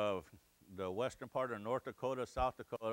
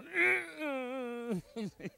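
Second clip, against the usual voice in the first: helpless laughter; 13 semitones higher than usual. Retold or imitated.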